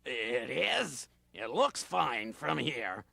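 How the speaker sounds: grumpy sleepy raccoon. Acted. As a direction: woken raccoon grumbling. Low voice.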